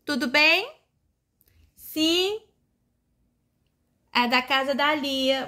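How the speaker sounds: a light play-phone hello; pretend phone call. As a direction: answer a toy phone for a toddler. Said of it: "brinque de telefone". (atendendo um telefone de brinquedo, de leve)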